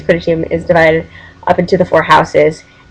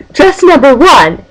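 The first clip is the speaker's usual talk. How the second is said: imitated announcement; 8 semitones higher, 3 dB louder, slower than usual. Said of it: "she goes".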